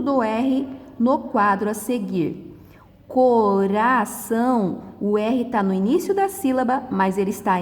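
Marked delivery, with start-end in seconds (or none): 3.1-4.8 slow "Coração" with a tapped R; weak, soft R. Shown as a demonstration. Teacher contrasting R between vowels, slow soft tapped R. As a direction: say "Coração" syllable by syllable, R lightly flapped. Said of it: "Aí ele tem o som fraco ou brando".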